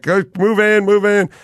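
high, directing a crowd; photographer calling out. Retold or imitated.

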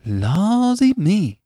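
high, drawn-out country woman's exclamation; astonished exclamation. A narrator's reading. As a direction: stretch it out high in amazement. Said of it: "exclaimed Missus Race".

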